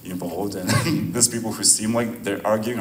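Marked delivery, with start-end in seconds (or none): none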